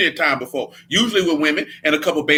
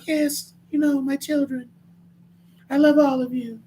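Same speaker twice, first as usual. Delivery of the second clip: high, soft, dainty voice; mock sweet-talker; 10 semitones higher, 3 dB quieter than usual. Man mocking preachers who won't mean what they say, high sugary voice. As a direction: put on a high, soft, simpering voice.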